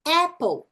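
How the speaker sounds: "Apple" with a wide open ae; short A. Shown as a demonstration. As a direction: stretch a smile on "Apple". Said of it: "Som curto da letra A".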